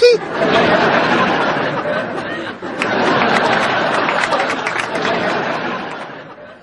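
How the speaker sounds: high breathy burst of laughter; big laugh. Real, not acted. Co-host laughing hard at the patient's answer. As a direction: crack up at the punchline.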